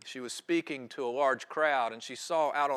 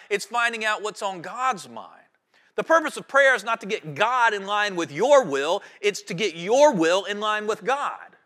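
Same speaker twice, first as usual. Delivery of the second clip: louder preaching, each "not" and "it's" hit hard; pulpit-strong contrasting lines; emphatic preaching; 4 semitones higher, 5 dB louder than usual. Real, not acted.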